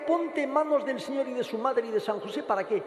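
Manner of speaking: usual speaking voice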